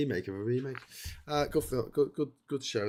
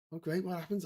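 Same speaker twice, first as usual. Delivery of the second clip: mock eager listener; 7 semitones higher than usual. Acted film pitch dialogue.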